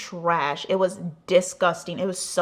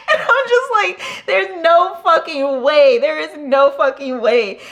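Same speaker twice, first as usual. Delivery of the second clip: higher, louder, slow laughing disbelief; 8 semitones higher, 9 dB louder, slower than usual. Real, not acted. Retelling the dead club, laughing in loud disbelief. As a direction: laugh through the words in disbelief.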